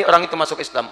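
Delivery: ordinary delivery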